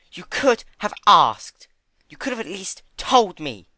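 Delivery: loud high hurt reproach; hurt anger. Acted. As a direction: scold the friend who lied. Fluttershy on her hooves.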